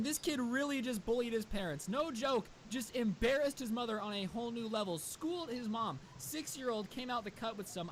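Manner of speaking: usual speaking voice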